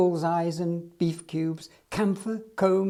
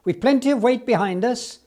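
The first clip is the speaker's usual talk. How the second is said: raised, louder encouragement; shouting; 6 semitones higher, 5 dB louder than usual. Acted. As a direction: keep calling out to the engine. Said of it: "shouted Jones".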